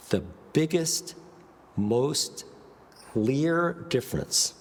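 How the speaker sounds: BIGGEST, MOST, CLEAR each set apart by a pause; dramatic pauses. Shown as a demonstration. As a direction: hold a beat before "biggest" and before "most". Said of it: "is the power of the pause".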